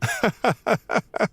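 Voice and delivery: short laugh. Real, not acted. Teacher amused by student's quip.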